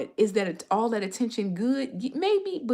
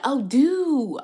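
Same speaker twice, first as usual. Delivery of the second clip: long, raised DO imitating a Swedish accent; imitated Swedish accent; 6 semitones higher, 8 dB louder than usual. Vlogger imitates her Swedish waiter saying DO, drawn-out Swedish accent. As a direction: mimic a Swede stretching DO, loudly. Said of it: "she said it in her accent".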